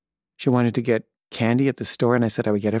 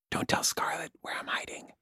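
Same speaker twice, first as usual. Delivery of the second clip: hushed unvoiced whisper; 13 dB quieter than usual. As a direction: whisper it like a kid hiding behind the couch. Child hiding asks dad secretly.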